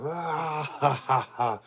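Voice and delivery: evil villain chuckle; sinister laugh. Pretend. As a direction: cackle like a movie villain. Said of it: "just have a sinister laugh".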